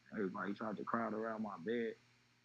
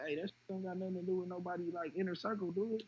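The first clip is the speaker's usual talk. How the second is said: higher, fast worried voice; retold anxious question; 8 semitones higher, faster than usual. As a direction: rushes to ask if it's inner circle. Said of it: "So I'm like".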